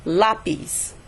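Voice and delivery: "Lápiz" with the weight on LÁ; stress on first syllable. Shown as a demonstration. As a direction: stress "lá" in "Lápiz", let "piz" fall. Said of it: "the word contains an accent over the A".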